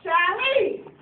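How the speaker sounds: mock angry dad calling out; stern dad. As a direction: call her out like an angry father. Dad scolding, retold.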